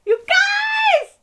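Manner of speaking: screaming with joy, very high and loud; ecstatic shout. Real, not acted. Viewer shrieking at the TV that she was right. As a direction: yell it out, overjoyed. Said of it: "Oh, I'm gonna cry".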